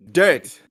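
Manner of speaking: Dirt with a sharp, clearly released T at the end; crisp final T. Shown as a demonstration. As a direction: land the T in Dirt. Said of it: "you have to pronounce the T at the end".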